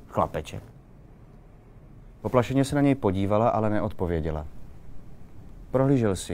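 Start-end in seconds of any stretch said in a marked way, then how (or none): 0.1-0.6 scornful little snort, then the word; understated sneer after a breathy scoff; mocking. A narrator's reading. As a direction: throw the word back with derision. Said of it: "výsměšně řekl".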